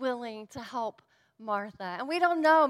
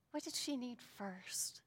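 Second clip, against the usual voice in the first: whispered repeat; hushed whisper; 12 dB quieter than usual. Acted. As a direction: breathe the question again in a whisper.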